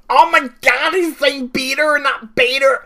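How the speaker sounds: high, loud, outraged American caricature; hammed-up American complaint, high-pitched and loud; mock American accent. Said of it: "fifty million Americans going".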